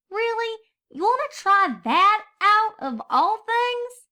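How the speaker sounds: put-on high, apologetic young filly voice; little filly Apple Bloom. A narrator's reading. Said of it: "asked Apple Bloom".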